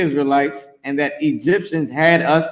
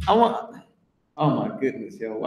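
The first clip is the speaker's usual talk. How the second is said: usual speaking voice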